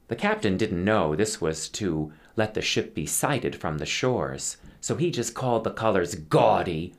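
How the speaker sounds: ordinary delivery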